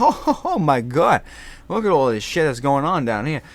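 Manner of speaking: loud, laughing Boston outburst; Boston guy cracking up; laughing Boston accent. Retold or imitated. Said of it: "I took in the Boston accent".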